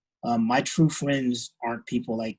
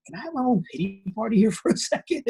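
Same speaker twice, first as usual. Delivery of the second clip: high, laughing sulky plea; mock self-pity; 10 semitones higher than usual. Inner reply to mom after losing, laughing mock self-pity. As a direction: ham up feeling sorry for himself.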